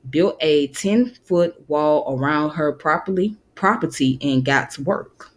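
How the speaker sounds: usual speaking voice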